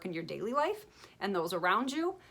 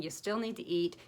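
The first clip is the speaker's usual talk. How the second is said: usual speaking voice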